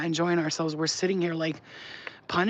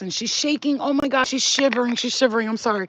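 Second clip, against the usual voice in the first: alarmed concern; 5 semitones higher, 6 dB louder than usual. Real, not acted. Dog shivering, owner alarmed.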